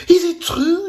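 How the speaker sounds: put-on high, loud girlish cry; excited cry. A narrator's reading. Mirette races in to question Bellini, excited cry. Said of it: "she cried".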